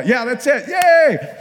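loud, high, hammed-up cheer; mock cheering. Coaches celebrating a beginner's tongues, hammed-up cheering. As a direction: cheer them on, shooting up in pitch and volume.

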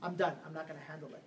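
low, quiet whining gossip; murmured grumbling to a friend; hushed complaint. Retold or imitated. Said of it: "So then".

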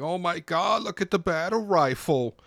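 mock hype. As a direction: ham up a hyped fanboy. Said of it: "they're like".

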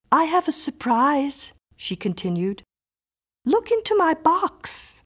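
put-on high, self-possessed girl's voice; little girl Miriam. A narrator's reading. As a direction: voice Miriam, a composed child, high and pleased. Miriam presents her doll box.

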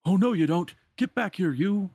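high, loud cartoon threat; villain's chasing shout. Pete chasing the peddler with the lamp, shouting. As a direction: holler after someone getting away.

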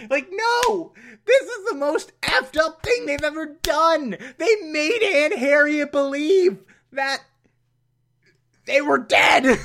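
high, wailing indignation; outraged rant. Real, not acted. Reviewer protesting what they did to Aunt Harriet, wailing outrage. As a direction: cry out in appalled disbelief.

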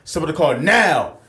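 loud, slow barked order; slow, loud shouted command; mock cop shout. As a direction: bark it like a cop losing patience. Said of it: "He screams".

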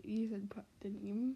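ordinary talk

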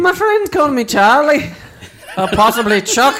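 lilting Irish self-introduction; Irish accent. Acted. Irish character introducing himself to the hosts, playful Irish accent. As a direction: introduce yourself as an amused Irishman. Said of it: "good friend from Ireland".

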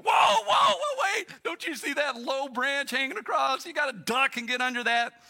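high, loud whoas of alarm; urgent warning shout. Retold or imitated. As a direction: shout as if halting someone mid-step. Acted urgent warning of a branch.

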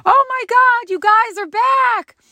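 high, loud, thrilled welcome back; squealing oh my God; excited greeting. Retold or imitated. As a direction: gush with delight at seeing them. Friend greets returning boy.

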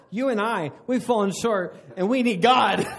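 laughing mock accusation; mocking wrong way. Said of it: "I'm not saying do that".

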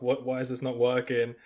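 usual speaking voice

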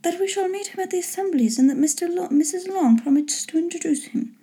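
put-on high-pitched young lady of the story; high, measured daughter voice; reasoning daughter Elizabeth. A narrator's reading.

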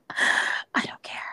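slow, voiceless whisper; breath-only, drawn-out aside; emphatic whisper. Real, not acted. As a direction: whisper it slowly, drawing out the last word. Dismisses worry about gaining weight.

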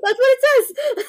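high, squeaky giggling words; slowed, laughing so hard it squeaks; stifled giggles. Real, not acted. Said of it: "I'm trying not to laugh".

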